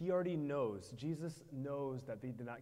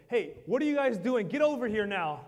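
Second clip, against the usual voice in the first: much higher and louder, a furious shout; mock angry yelling; 7 semitones higher, 12 dB louder than usual. Preacher acting out an angry Jesus yelling at the disciples, shouting. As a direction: bark it at them like an angry boss. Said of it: "Is he yelling at them".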